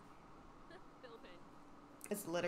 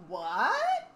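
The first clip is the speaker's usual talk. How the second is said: mock shocked squeak; 12 semitones higher, 10 dB louder than usual. Voicing the sim's shocked reaction.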